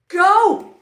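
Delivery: a sudden loud high shout of go. Acted. Narrator shouting go to start the juggling. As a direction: burst out with a loud go.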